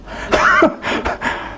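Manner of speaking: high airy cackle at her own joke; high burst of laughter. Real, not acted. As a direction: crack up at yourself. (높고 숨소리 섞인 웃음)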